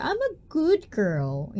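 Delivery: put-on slow, high cutesy good girl. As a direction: coo it like a sweet innocent girl. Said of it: "I always try to say it with a straight face".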